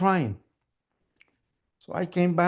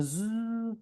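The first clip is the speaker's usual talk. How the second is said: high, softer motor whine; speeding machine buzz; 7 semitones higher, 7 dB quieter than usual. Acted. Machine running as mom cries, high buzzing. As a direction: hum like a racing sewing machine. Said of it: "the faster the machine went".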